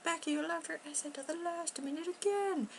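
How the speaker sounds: high mock nagging voice; scolding family. Family scolding Becky for the late essay, high mock nag. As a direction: nag her like the family does. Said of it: "they're like".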